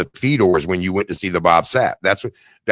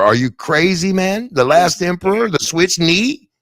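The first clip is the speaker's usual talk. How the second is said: incredulous; 8 semitones higher than usual. Real, not acted. Incredulous at a bold move.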